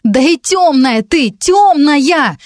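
high, drawn-out indignant outburst. A narrator's reading. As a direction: protest hotly, pitch pushed up. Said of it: "возмутилась Вика". (возмущённо)

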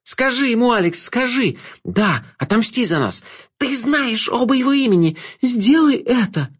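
very high, strained demand; high, insistent cry for revenge; frantic urging. A narrator's reading. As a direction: implore her at the top of the pitch.

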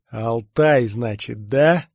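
forceful, hissed recognition; menacing hiss. A narrator's reading. Stormtrooper learns who his challenger is, hissing. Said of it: "прошипел он".

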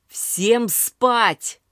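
loud. A narrator's reading. Son orders drunk women.

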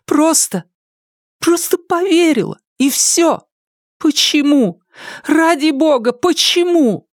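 very high, pleading despair; anguished self-reproach. A narrator's reading. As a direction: build to a high, desperate plea. Heroine anguished, blaming herself. (отчаянно, высоким молящим голосом)

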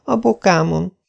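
high, a hint of a groan; Anne groaning. A narrator's reading. Anne telling where it hurts, mild groan. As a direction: say it through pain. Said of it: "nyögte Anne".

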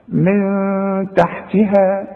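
من تحتها with a held nasal hum on the نون; nasalized نون. Shown as a demonstration. Tajweed teacher demonstrating ikhfaa, slow nasal. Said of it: "يكفي انك انت تغني هنا".

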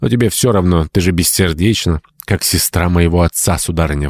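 usual speaking voice

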